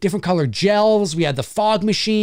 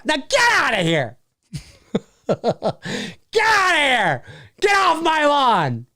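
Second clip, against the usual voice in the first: high, drawn-out comic yell; mock angry shout; 5 semitones higher, slower than usual. Host chasing off costume-less teens, hammed-up yell. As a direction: holler it slowly across the yard.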